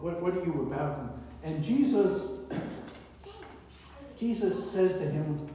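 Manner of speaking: ordinary delivery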